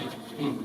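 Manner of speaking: soft sheepish hmm; a quiet guilty hmm; caught-out hum. Retold or imitated. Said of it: "And Joe's like".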